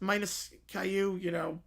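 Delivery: ordinary delivery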